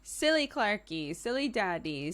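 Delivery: usual speaking voice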